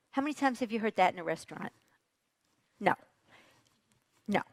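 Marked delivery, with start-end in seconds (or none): none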